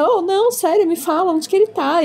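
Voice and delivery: high-pitched, pleading interrogation; imitating a pestering pupil; insistent questioning. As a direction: press the teacher for an answer. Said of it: "ele fica interrogando ela".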